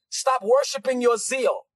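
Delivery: ordinary talk, nothing marked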